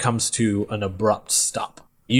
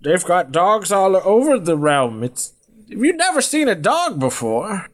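high, incredulous character voice; puzzled old man; 8 semitones higher than usual. Acted. Old man questions the stranger about dogs, high baffled voice.

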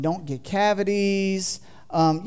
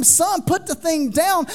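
higher, louder, slow urgent yell; mock scream; 8 semitones higher, 4 dB louder, slower than usual. Father yelling at son holding a copperhead, higher slow yell. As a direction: yell it like the boy holds a snake. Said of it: "I'm gonna scream".

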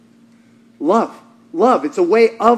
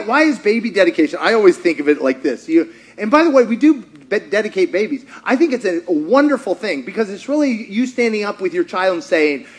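ordinary talk

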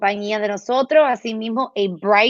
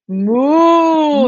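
loud, high mooing imitation; cow moo; 5 semitones higher, 5 dB louder than usual. Shown as a demonstration. Therapist shows a child the cow's sound, loud playful moo.

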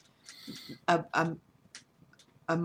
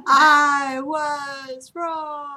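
much higher, louder, very slow lament; mock dramatic confession; 9 semitones higher, 7 dB louder, much slower than usual. Son turning to ash as he leaves, hammed-up slow wail. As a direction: draw out a hammed-up dying admission. Mock dying son's last words.

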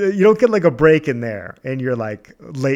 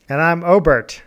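normal talking voice

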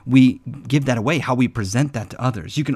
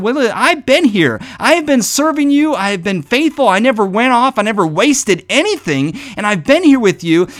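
higher, aggrieved protest; upset older brother; 8 semitones higher, 4 dB louder than usual. Retold or imitated. Older brother complaining to the father, high and aggrieved. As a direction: pour out the grievance in a high, wounded voice. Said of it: "he got very upset, and he said".

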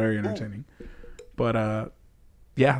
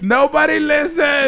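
mock complaint; 9 semitones higher than usual. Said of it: "Lindsay's really big on this".

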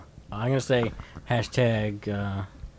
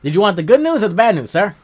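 put-on high, fast old-timey salesman; 8 semitones higher, 8 dB louder, faster than usual. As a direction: pitch it like a vaudeville host. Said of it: "Just do it old-timey".

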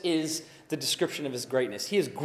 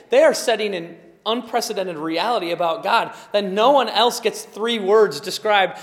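usual speaking voice

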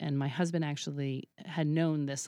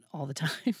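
ordinary delivery

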